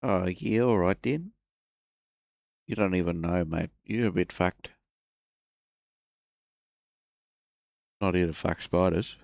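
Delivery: bad Australian accent. Announcing bad accent time, then playing an Aussie bloke. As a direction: lay on a cheesy Australian accent. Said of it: "Bad accent time".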